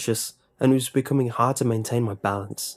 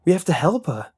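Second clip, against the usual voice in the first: cried out; 8 semitones higher, 4 dB louder than usual. A narrator's reading. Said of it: "Nick cried".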